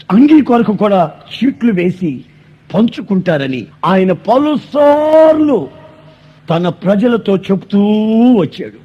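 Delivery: loud, pounding sermon delivery; full-force preaching. Acted. Pastor telling the congregation of Christ's suffering, full-force preaching. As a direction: preach it to the back rows.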